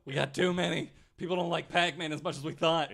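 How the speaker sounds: mock Atari executive. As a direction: play a worried exec over unsold stock. Voicing Atari executives, mock lament.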